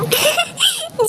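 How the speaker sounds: a high, soft laugh, falling away; amused laugh. Acted. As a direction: laugh at the silly line. Jiju teased about beautiful.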